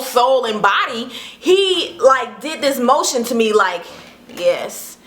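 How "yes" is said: low soft come-on voice; deep smooth yes. Retold or imitated. The dancer beckoning her over, low suave yes. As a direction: lure her in, low and slow. Voicing the flirting guy.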